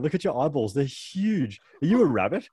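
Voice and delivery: put-on taunting kids. Quoting the kids who called him a rabbit, jeering. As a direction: tease like a pack of cruel kids.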